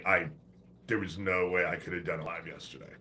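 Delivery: ordinary talk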